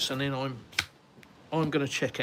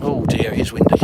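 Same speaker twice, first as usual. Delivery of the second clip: over the wind; 2 semitones lower, 12 dB louder than usual. Real, not acted. Out in wind on terrace.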